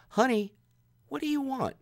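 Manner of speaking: high, sugary mock lovey-dovey boyfriend; sappy boyfriend. Mimicking the doting boyfriend asking his date, sugary high voice.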